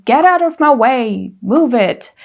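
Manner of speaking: a touch of an angry shout; mock shout. Shown as a demonstration. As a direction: shove through with a half yell. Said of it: "Instead of shouting".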